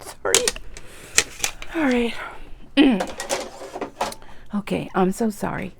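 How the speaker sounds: soft apology through tears; real tearful voice. Real, not acted. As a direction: pull yourself together after crying, quietly. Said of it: "I apologize for my crying".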